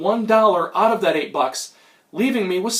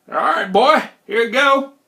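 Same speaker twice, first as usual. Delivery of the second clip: put-on higher old hustler voice; Old Man McGillicuddy; 4 semitones higher, 4 dB louder than usual. Old hustler collecting from the visitor, folksy character voice. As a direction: gloat like a folksy old card sharp. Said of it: "Mister McGillicuddy".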